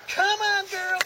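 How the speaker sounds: ordinary talk, nothing marked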